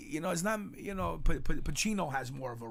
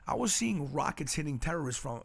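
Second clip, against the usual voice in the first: usual speaking voice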